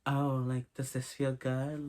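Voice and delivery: hushed, slow, gentle check-in; soft approach. Acted. Experienced partner easing in a nervous beginner, softly. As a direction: ease in very softly and slowly. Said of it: "approach it more in a soft way".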